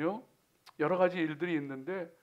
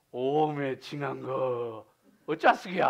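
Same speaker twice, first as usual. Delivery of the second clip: slow, drawn-out Jeolla lament; Jeolla dialect; 6 semitones lower, 4 dB louder, slower than usual. Shown as a demonstration. Preacher commiserating over a hard life, Jeolla dialect. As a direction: stretch it out in Jeolla accent. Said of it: "전라도 말로 하면은요".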